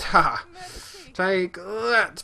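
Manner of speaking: high, punched-out mock battle cry; playful combat gusto; triumphant battle cry. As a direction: land the blow with a "ha!". Striking an enemy in game combat.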